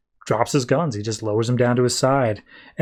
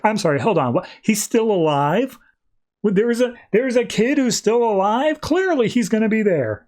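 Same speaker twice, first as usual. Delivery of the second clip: disbelief; 9 semitones higher than usual. Retold or imitated. Grayson learns the kid is still alive, incredulous. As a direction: act out an incredulous double take, voice raised. Disbelieving retort acted out in retelling.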